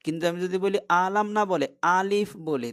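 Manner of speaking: ordinary delivery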